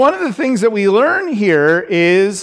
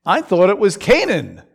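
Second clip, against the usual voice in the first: ordinary talk, nothing marked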